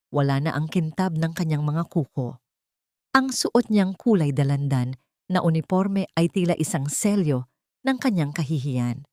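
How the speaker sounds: plain reading voice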